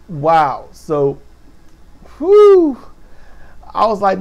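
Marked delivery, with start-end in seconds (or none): 2.2-2.8 high, loud exhaled whew. Real, not acted. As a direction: blow out a big relieved whew. Reacting to the show.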